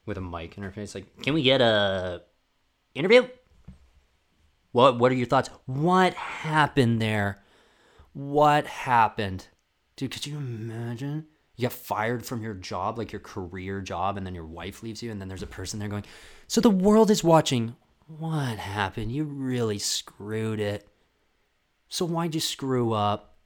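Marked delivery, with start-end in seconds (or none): 1.2-9.5 mock intrusive interviewer; pushy reporter. Mock reporter in athlete's face. 16.5-23.4 slow, mock-earnest prying questions; mock reporter badgering; pushy interviewer.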